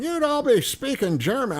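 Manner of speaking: high, jeering taunt; imitating drunk friend. Retelling his friend's drunken jab at Brits, high mocking taunt. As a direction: mimic her drunken brag at the party. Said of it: "she'd be going".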